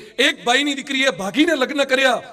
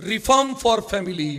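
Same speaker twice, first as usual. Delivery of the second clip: reform for family, drawn out slow with reform stressed and falling; deliberate motto read; slow slogan; much slower than usual. Shown as a demonstration. Slogan stated to the congregation.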